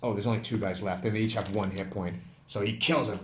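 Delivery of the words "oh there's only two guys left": ordinary talk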